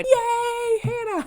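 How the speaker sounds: squealed mock cheer. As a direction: cheer like the house table. Cheering as the students.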